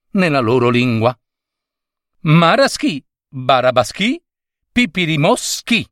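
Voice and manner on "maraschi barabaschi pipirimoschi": put-on higher, playful child's gibberish; child's nonsense words. A child talking secret language to his friend, playful. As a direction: chant the made-up words like a kid. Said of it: "disse il primo".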